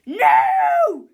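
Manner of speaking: very loud, stretched scream; exploding teacher's shriek. A narrator's reading. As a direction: scream the refusal. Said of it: "screamed Miss Ribble".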